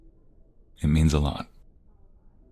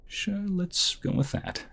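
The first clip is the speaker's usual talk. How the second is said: mock reluctant; 4 dB quieter, slower than usual. Teasing reply to a nickname.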